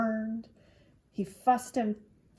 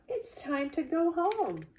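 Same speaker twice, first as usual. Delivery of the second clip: teacher's announcement; 9 semitones higher, 3 dB louder than usual. Acted. Teacher announcing home time.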